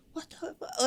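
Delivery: breathy high whisper of disbelief; half-whispered what the; whispered mock shock. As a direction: gasp it under your breath. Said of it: "I, I'm like".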